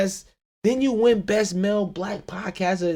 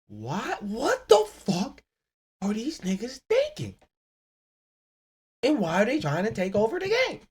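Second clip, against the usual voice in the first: baffled listener voice; 6 semitones higher than usual. Pretend. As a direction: play the stunned listener.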